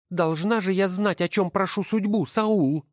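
high, scornful woman's voice; wife's voice. Acted. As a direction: sneer at each title as you sort them. Wife answers her son. (высокий насмешливый голос жены)

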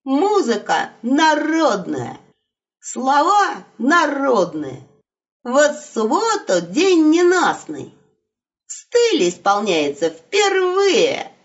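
solemn, slow concert announcer; booming circus ringmaster. Acted. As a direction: announce like a gala compere. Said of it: "торжественно, как конферансье". (торжественно, как конферансье на юбилее)

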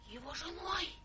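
hushed, stunned gasped words; gasping in horror; stunned disbelief. Acted. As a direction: repeat it in breathless shock. Stunned at the news.

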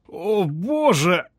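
slow, high drawn-out moan; anguished groan. A narrator's reading. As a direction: let despair pull it long. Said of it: "застонал мужчина".

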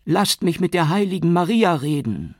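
high, loud desperate scream; frantic woman screaming to be let go; screaming plea. A narrator's reading. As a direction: shriek it while struggling against the police. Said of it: "schrie Dumia".

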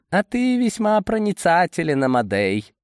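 high-pitched sarcastic compliment. A narrator's reading. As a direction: pitch it up high and mock him. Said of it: "с сарказмом заметил Борис".

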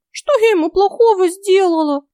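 very high, whiny fairy voice; fake-tearful squeak; mock-tearful fairy. A narrator's reading. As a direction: whine like a wronged little fairy. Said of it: "притворно зашмыгала носом".

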